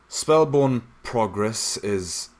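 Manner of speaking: slow, loud, otherworldly recorded voice; statue log voice. Acted. The statue plays its log to the party, slow and loud. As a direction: drag the words out like an old recording.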